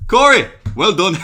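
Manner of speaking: loud, high-pitched Geordie impression; Geordie accent. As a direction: call it out in broad Geordie. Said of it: "that was a lot better than mine".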